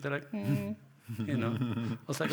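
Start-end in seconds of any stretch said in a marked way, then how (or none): 0.3-0.7 high, held-out "hmm"; stumped pondering. Retold or imitated. Studio people asked whom to shoot, stumped pondering. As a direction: mimic someone stumped for an answer.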